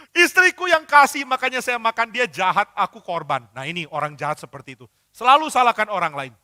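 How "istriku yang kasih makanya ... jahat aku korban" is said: whiny blame. Acted. Adam answering God by blaming his wife, whiny and loud. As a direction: shift all the blame like a sulky child.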